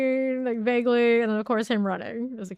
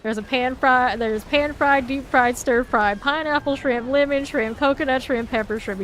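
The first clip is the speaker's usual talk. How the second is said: put-on loud, slow, higher Southern accent reciting shrimp dishes; Southern drawl shrimp list; 3 semitones higher, 7 dB louder than usual. Gamer quoting the shrimp list, in a loud Southern accent. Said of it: "You gotta say it that way".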